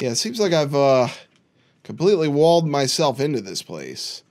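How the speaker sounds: normal talking voice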